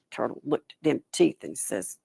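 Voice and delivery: plain reading voice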